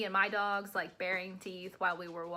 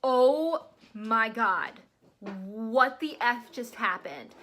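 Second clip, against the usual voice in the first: slow, louder stunned gasp of disbelief; shocked disbelief; 6 dB louder, much slower than usual. Retold or imitated. Owner right after the bite, slow stunned shock. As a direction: drag each word out, stunned the dog just bit someone. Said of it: "I am like".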